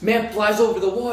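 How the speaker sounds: ordinary delivery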